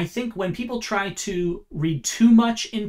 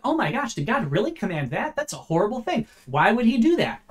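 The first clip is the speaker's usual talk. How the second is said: higher-pitched, exaggerated incredulous questioning; shocked disbelief; 5 semitones higher than usual. Serpent feigning shock to Eve at God's command, shocked disbelief. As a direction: act appalled and cast doubt on the command. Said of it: "an interrogative expressing surprise".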